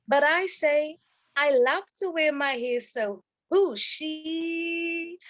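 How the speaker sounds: rhythmic performance-poetry delivery; spoken word poem. Acted. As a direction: perform the poem for the audience with swing.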